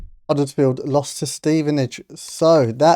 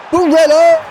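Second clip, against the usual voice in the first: high, loud rising call of the name; excited call; 11 semitones higher, 5 dB louder than usual. Real, not acted. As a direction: urge the player on as he breaks through. Through ball, player running in.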